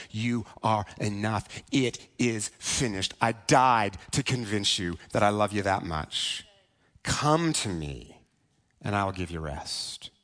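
low, slow, softened voice of God; tender reassurance. Retold or imitated. God looks at you and reassures you, low and tender. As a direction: speak slowly and gently, leaving room after each phrase.

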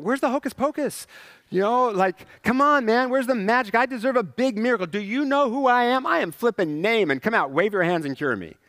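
entitled outrage. Retold or imitated. Naaman demanding the prophet's magic, entitled outrage. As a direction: rant like a spoiled big shot.

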